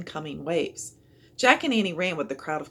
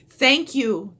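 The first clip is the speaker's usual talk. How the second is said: louder, higher grateful call; cried out; 7 semitones higher, 8 dB louder than usual. A narrator's reading. Father thanking Jack for saving his boy, a loud cry. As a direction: call thanks over the flood. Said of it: "the boy's father cried".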